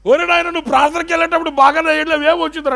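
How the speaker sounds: startled, demanding what happened; worried relative. Retold or imitated. Family member alarmed at the mute priest, fast and high.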